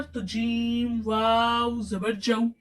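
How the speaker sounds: slow spelling of जीम वाव जबर जऊ; slow spelled-out reading. Shown as a demonstration. Teacher showing students the spelling, slow and careful. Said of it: "teacher demonstrates spelling".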